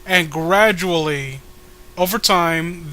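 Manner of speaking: ordinary delivery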